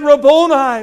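a glad, loud cry of recognition; joyful cry. Acted. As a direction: call out in joyful surprise. Mary crying out to Jesus.

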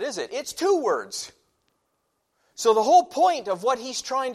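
loud, high preaching voice; emphatic preacher teaching grammar; preaching at full force. Acted. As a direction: drive the point home from the pulpit.

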